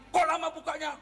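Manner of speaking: high, peevish door complaint; mock angry husband. Husband home late scolding his wife, mock angry. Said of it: "dia marah duluan".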